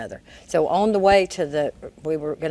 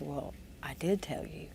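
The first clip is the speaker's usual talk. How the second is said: whispered reply; 12 dB quieter than usual. Retold or imitated. Quietly reminding him.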